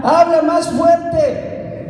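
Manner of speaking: high, drawn-out heckle from the back. Retold or imitated. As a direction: imitate a listener calling up, slowly and high. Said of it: "le vuelve a decir la persona".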